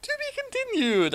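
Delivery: very high mock announcer line. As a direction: say it like a TV cliffhanger announcer.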